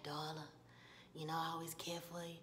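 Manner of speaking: low, soft, tender man's voice; Ralph Angel. Retold or imitated. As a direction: reassure her quietly, voice dropped. Voices Ralph Angel reassuring Darla.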